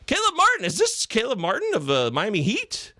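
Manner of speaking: high-pitched surprised greeting; playful astonishment. Real, not acted. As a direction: squeak the name in delighted disbelief. Greets a surprising name in chat.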